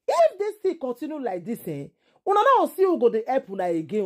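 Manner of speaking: ordinary talk, nothing marked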